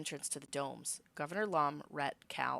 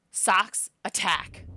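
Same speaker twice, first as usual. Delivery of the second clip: loud, raised command to strike; attack command; 4 semitones higher, 10 dB louder, much slower than usual. Acted. The hero orders Socks to attack Lum, loud. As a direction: bark the order at the pet.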